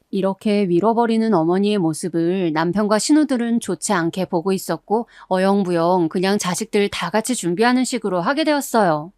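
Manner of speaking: usual speaking voice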